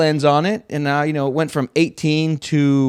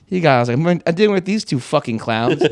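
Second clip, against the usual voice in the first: usual speaking voice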